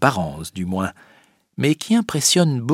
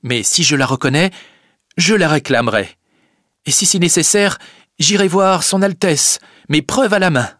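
put-on a young girl's raised, earnest voice; Rosa's voice; 8 semitones higher, 4 dB louder than usual. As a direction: plead her case firmly. Rosa voiced in story.

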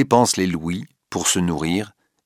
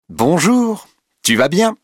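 Rachel's sweet-sour hello, higher and louder; mocking greeting; 11 semitones higher, 5 dB louder, slower than usual. A narrator's reading. Rachel accosting Duroy in his box, loud mocking greeting. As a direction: taunt him with a loud, sugary hello. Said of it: "lui dit Rachel".

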